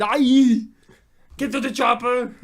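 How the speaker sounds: loud Austrian action-hero bellow; Schwarzenegger. Shown as a demonstration. Catchphrase demo of the action star, bellowed. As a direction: shout it in a put-on Austrian accent. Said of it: "You do a real good Schwarzenegger".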